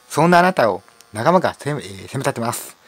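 usual speaking voice

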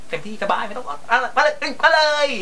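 high mocking taunt, daring them on; thai slapstick. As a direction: goad the hitter in a squeaky high voice. Taunting viewer while being hit. (ท้าทายเสียงสูงแบบตลก)

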